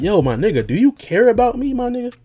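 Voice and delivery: high, pleading retold complaint; a son's high mock appeal to his father; mock plea to dad. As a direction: whine it up high like a hurt kid. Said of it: "I'm just like".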